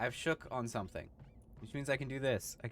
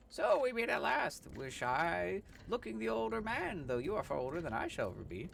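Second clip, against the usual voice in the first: grand Time Wizard; 8 semitones higher than usual. Acted. Time Wizard greeting the player, grand and mystical. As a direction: proclaim like an ancient sage.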